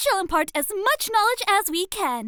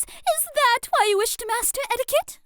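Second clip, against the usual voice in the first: ordinary talk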